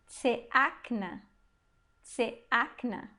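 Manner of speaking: tseakna as short tse, then a stressed AK, then na; careful tseakna, leaning on the middle syllable AK; stressed-syllable demo. Shown as a demonstration. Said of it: "que faz a sílaba tônica".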